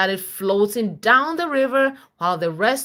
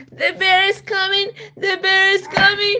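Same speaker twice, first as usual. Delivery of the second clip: much higher, louder panicked cries; panicked kids; 12 semitones higher, 4 dB louder than usual. Acted. Kids in the story flee the bear, high and panicked. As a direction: cry out as if fleeing a bear.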